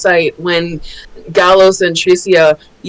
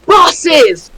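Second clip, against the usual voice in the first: excited shout; 9 semitones higher, 5 dB louder than usual. Real, not acted. Excited about bus pictures.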